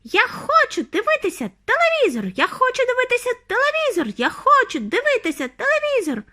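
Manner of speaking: very high, insistent tiny squeal; high-pitched childish tantrum. A narrator's reading. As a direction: squeal the demand like a tiny boy. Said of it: "пищав він".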